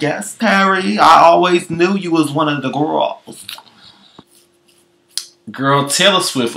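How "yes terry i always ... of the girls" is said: sassy cheer. Retold or imitated. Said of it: "I was like".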